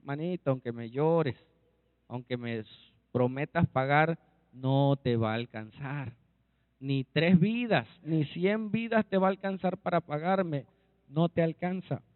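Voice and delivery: low, discouraging reply of a creditor; lord's refusal. Acted. Lord answers his pleading debtor that it will never suffice, low refusal. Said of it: "¿Qué iba a decir el Señor?".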